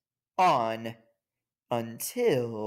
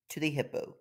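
ordinary delivery

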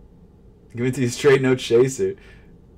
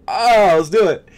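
hyped up; 10 semitones higher, 9 dB louder than usual. Real, not acted. Psyching up for checkout.

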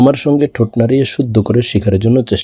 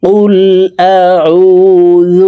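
high, very slow melodic chant; chanted Quranic verse, raised pitch; Quran recitation; 7 semitones higher, 4 dB louder, much slower than usual. Shown as a demonstration. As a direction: stretch every syllable in recitation. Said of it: "সম্পূর্ণ আয়াত বলে দিচ্ছি".

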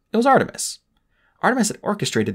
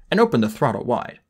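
usual speaking voice

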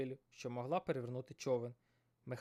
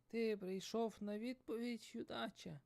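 put-on old sage voice; 9 semitones higher, 3 dB quieter, slower than usual.

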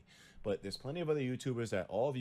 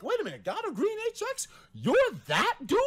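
very high, loud amazed cry; can't believe who's standing there; retelling meeting Green, shrieking high in disbelief; starstruck disbelief; 7 dB louder than usual. Retold or imitated. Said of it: "I was like".

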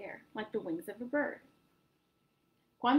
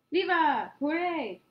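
cheer; 6 semitones higher, 7 dB louder, slower than usual. A narrator's reading. Children cheer.

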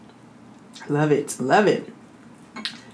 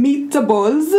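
mock Italian accent, higher and lilting upward; 6 semitones higher than usual. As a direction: put on a playful Italian accent. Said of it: "That was my Italian accent".

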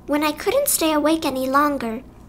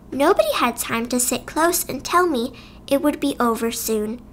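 ordinary delivery

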